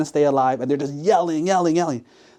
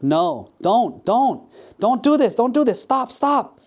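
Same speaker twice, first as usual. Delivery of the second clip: rising to high-pitched frantic pleading; serious, urgent protest; 10 semitones higher than usual. Acted. Woman about to be thrown in water, urgently protesting. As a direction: protest firmly, climbing into near-screams. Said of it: "And she was serious".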